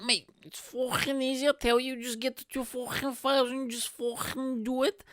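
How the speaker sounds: high-pitched drunk know-it-all mimicry; drunk guy impression. Drunk advising comedian on syncing files, high mimicked voice. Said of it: "He was like".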